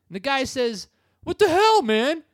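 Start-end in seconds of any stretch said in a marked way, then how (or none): 1.2-2.2 high, loud outraged yell; mock yell. Imitating the driver yelling at the man he hit. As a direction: shout at the guy.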